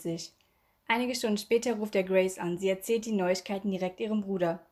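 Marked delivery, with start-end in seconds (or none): none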